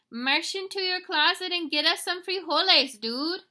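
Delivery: high, loud knock-knock punchline; shouting alien voice. Acted. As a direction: holler it like a cheeky cartoon Martian.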